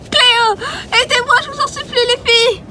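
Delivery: very high tearful pleading; shrill crying plea; crying for help. Acted. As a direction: beg your friends through tears. Captive cries to friends.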